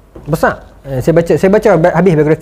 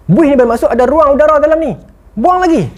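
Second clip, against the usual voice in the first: mild Steve Jobs boss; 8 semitones higher than usual. Retold or imitated. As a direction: command the engineer, a touch of a demanding boss. Steve Jobs memarahi jurutera. (bos tegas sedikit, lebih tinggi)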